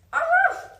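loud, high imitated bark; big pretend yap; dog bark. A narrator's reading. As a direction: bark sharply like an excited little dog. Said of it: "Teddy barked".

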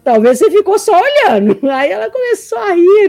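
high, loud punchline tipping into a laugh; amused teasing. Retold or imitated. Coach teasing a student about only watching, amused and laughing. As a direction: tease loudly, then laugh through the retelling.

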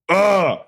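high, forceful "ugh"; pumped-up primal grunt; triumphant grunt. Retold or imitated.

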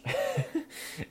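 a quick high unvoiced laugh; laughing mid-quote; breathy laugh. Real, not acted. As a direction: laugh at your own line. Laugh inside Thor quote.

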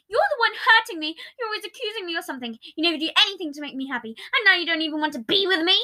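high, fast complaint; accusing. Acted. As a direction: rattle off the blame. Patton accusing rapidly.

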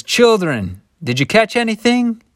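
high, loud call across the water; calling out from shore. Acted. Stranger on shore calls out to fishermen, high and loud.

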